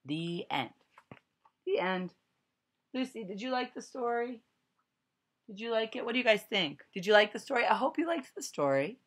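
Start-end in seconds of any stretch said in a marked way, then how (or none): 2.9-9.0 friendly question. Real, not acted. Asking child after reading.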